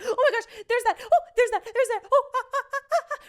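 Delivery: high, loud breathless fan exclamations; excited fan gasps. Retold or imitated. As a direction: point at each moment, overjoyed. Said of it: "quick moments that were just like".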